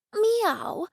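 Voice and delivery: high, sing-song cat meow; the cat answers with a high meow. Acted. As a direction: mew it like a cat. Cat Jennifer speaks.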